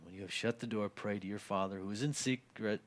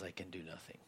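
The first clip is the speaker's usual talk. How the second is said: breathy hushed whisper, almost no voice; 3 semitones lower, 13 dB quieter than usual. Real, not acted. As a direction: drop to a whisper to drive it home. Whispered point about Jesus.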